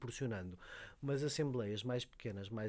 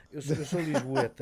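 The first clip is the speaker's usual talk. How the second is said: laughing admission; 3 semitones higher, 11 dB louder than usual. Real, not acted. Joking confession after playful lie.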